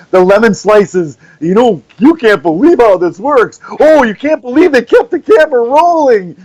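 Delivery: rising, hyped ad pitch; lightly hammed-up clickbait read. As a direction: sell it like a cheesy online ad. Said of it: "like the clickbait you see".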